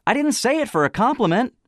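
high, loud retort; voice from platform. Acted. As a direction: snap back in a very high voice. Anonymous questioner on the platform.

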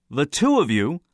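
high, loud, harsh questioning. A narrator's reading. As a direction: press the witness harshly. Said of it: "went on harshly".

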